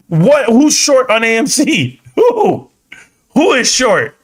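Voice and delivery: high laughing disbelief; incredulous. Real, not acted. Streamer challenges squeeze believers, incredulous. Scoffs at viewers' squeeze claim.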